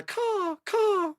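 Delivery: mimicked seabird call, long and open; imitated gull caw. Imitates gull cries in background.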